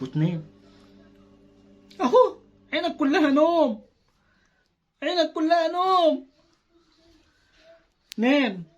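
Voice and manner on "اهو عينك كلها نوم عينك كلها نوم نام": higher, slow, louder sing-song; high drawn-out bedtime croon; sing-song coaxing. Acted. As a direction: croon it to make him sleepy.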